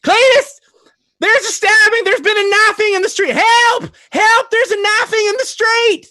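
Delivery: a hammed-up falsetto shriek of an old neighbour; Gladys yelling. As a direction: scream for help out the window, slow and panicked. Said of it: "Gladys yells out".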